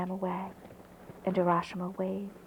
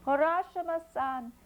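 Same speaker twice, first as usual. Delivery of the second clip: high calling voice across the water; a distant high call to the boat; calling from afar; 6 semitones higher than usual. Acted. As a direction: call his name from far off. Turtle calling from the ocean.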